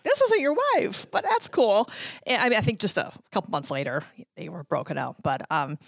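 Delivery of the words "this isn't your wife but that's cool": high, pointed mock aside; sarcastic under-the-breath judgement; wry inner thought. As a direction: raise the pitch with a knowing smirk. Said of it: "I'm like".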